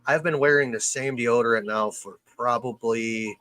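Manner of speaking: ordinary talk, nothing marked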